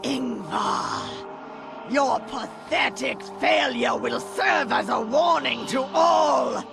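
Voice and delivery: high, building shouted condemnation; scornful shout. Acted. Master condemning Ingvar's failure, scornful shouting. Master scolds failed underling.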